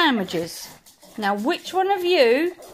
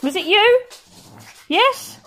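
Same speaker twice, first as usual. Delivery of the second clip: high playful excitement; 9 semitones higher than usual. Real, not acted. Owner answering her barking dog, high and playful. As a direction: egg the dog on.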